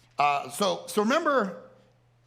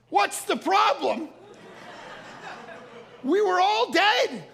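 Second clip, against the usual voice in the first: high, slow, incredulous echo; mock outrage, every word stretched out; exasperated outburst; 12 semitones higher, slower than usual. Husband answers oblivious wife after missile scare, exasperated.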